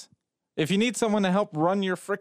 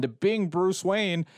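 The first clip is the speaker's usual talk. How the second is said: usual speaking voice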